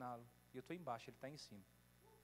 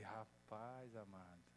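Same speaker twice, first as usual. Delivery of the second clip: very slow, low, soft stunned murmur; preacher stunned by God's answer; stunned awe; 8 semitones lower, 10 dB quieter, much slower than usual. Real, not acted. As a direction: let it sink in, dumbfounded. Stunned reaction to God.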